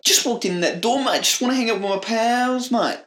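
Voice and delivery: high, hammed-up Aussie pal; chummy cartoon Australian; bad Australian accent. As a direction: put on an over-the-top Australian twang. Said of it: "is that how Australian people speak".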